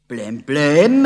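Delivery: loud, exasperated cry; mother shouting, loud and cross; exclaiming. A narrator's reading. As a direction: shout it out, fed up. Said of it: "rief sie".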